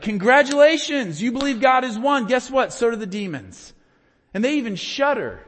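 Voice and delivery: hammed-up sarcasm like a slow clap; sarcastic slow clap. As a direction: James mocking the believers, loud sarcasm. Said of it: "I picture James doing, like, a slow clap".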